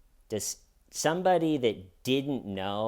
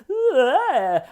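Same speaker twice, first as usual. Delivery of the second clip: high, breathy mock cry of shock; fake amateur gasp; 13 semitones higher, 7 dB louder than usual. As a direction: overact a cheap fake gasp. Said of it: "the actress playing Wendy went".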